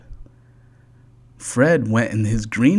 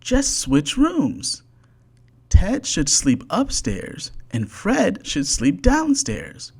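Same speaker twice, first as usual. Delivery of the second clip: high, perky little bird voice; small bird with a bright idea, high up; bird's voice; 7 semitones higher than usual. A narrator's reading. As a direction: chirp it like a know-it-all bird. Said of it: "said the bird".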